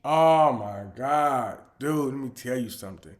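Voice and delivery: ordinary talk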